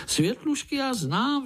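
very high, eager blurt; excited outcry. A narrator's reading. Pája interrupts uncle about fireflies, excited outcry. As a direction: pipe up high, like a little know-it-all. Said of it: "vykřikl Pája".

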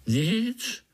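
tiny high puzzled voice; puzzled little spider. A narrator's reading. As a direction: answer in a high, rising squeak. Puzzled spider answers Pája. (vysoký udivený hlásek pavoučka)

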